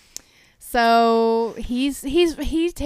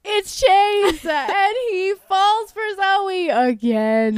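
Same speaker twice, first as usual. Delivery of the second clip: laughing; 7 semitones higher, 3 dB louder, much slower than usual. Real, not acted. Laughing over the reveal.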